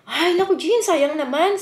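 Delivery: a lightly voiced friend exclaiming in pity; friend's dismay. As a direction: play the friend's lightly voiced disappointed cry. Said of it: "Sabi niya".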